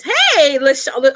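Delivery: sudden loud, high hello; excited greeting. Real, not acted. Coach spots a longtime client joining, excited greeting. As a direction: call out happily to a newcomer. Greeting a viewer who arrives.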